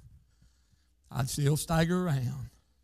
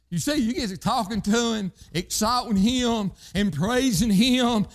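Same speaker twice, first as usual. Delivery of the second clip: rising, pressing preacher cadence; preaching at full force; 6 semitones higher than usual. Acted. Preacher urging praise in trouble, building cry. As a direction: urge them on, building.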